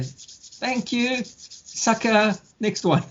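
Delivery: high, sly scammer voice as he pockets the cash; mock the victim like a smug con man; gloating scammer.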